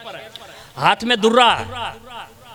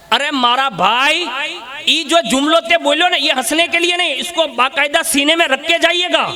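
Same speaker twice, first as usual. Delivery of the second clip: preacher urging brothers, raised and earnest; urgent exhortation; 6 semitones higher than usual. Real, not acted. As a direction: press it into their hearts. Preacher urges audience.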